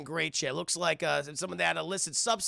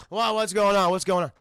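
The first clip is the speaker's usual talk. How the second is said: loud, mock groggy alarm; louder, confused blurting; startled awake; 3 semitones higher, 8 dB louder than usual. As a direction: act jolted from sleep. Sleeper nudged awake.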